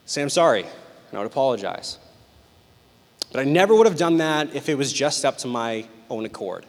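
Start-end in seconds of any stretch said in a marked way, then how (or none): 0.2-2.0 mildly grudging retold apology; begrudging. Grandchild apologizing to mom for grandmother's sake, begrudging. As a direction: say it as a reluctant duty. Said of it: "begrudgingly and kinda out of commitment".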